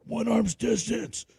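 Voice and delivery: high, slow, strained mock command; drill instructor. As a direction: imitate an instructor calling out drill orders. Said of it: "the drill instructor's just like".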